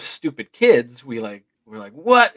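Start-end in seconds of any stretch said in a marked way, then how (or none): 1.9-2.3 put-on high, loud kid's outburst; excited astonishment. Stupid kids spotting the lights, astonished shout. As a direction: blurt it in loud, rising disbelief.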